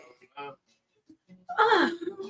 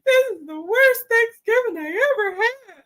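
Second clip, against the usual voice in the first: higher, tearful whine of a daughter; imitating crying daughter; 10 semitones higher, 3 dB louder than usual. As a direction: play the upset daughter, half mocking. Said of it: "My daughter called me crying".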